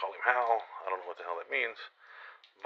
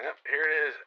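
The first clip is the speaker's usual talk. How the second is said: resigned loud quip; 7 semitones higher, 7 dB louder than usual. Retold or imitated. Resigned as the gun is raised.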